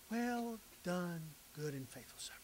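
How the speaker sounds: soft echo. Acted. Echoing the Lord's praise softly.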